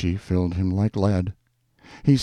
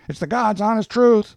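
high-pitched protest; insistent neighbour; 14 semitones higher, 4 dB louder than usual. A narrator's reading. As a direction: insist in a raised street-tough voice. Hank insisting during quarrel.

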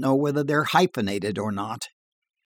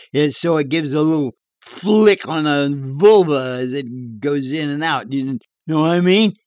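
higher, louder bar yarn voice; tall-tale barfly; 3 semitones higher, 6 dB louder than usual. Acted. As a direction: spin a dirty joke in a bar.

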